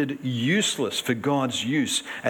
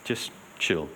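laid-back God; 10 semitones lower, 7 dB quieter than usual. Retold or imitated. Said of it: "If God ever said this".

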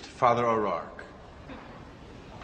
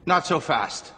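put-on raised pitch, Irish-accented priest; attempted Irish accent; 5 semitones higher, slower than usual. Priest stopping the woman's plea for forgiveness, attempted Irish accent. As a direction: halt them in a rough Irish accent. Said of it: "Can't do the Irish accent".

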